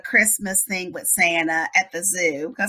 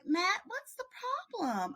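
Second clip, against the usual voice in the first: soft, raised-pitch coaxing; gentle questioning; 10 semitones higher, 11 dB quieter than usual. Retold or imitated. Mom asking the boy in the car, gentle. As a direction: ask it softly, as to a small child.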